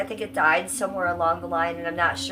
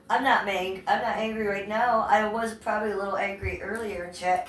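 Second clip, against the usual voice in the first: usual speaking voice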